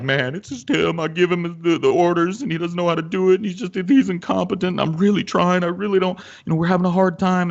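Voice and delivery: high-pitched, pleading waitress imitation; sob story. Waitress blaming the cook to customers, tearfully pleading. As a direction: mimic an upset worker pouring out complaints. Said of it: "a sob story about how".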